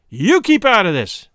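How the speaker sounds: loud, high, fierce order; fierce cry. A narrator's reading. Womble turning on the woman to silence her, fiercely. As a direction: round on her, raising the voice sharply. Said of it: "turned upon her fiercely".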